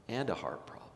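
breathy whispered aside; hushed preacher's whisper. Acted. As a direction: drop to a whisper for weight.

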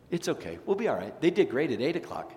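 ordinary delivery

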